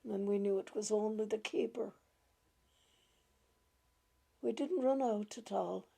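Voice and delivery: usual speaking voice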